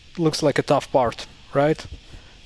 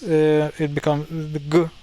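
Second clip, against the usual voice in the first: ordinary delivery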